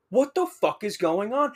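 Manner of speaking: high-pitched, baffled exclamation; retold bewilderment. Student seeing the bent door, baffled alarm. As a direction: let the pitch leap in disbelief.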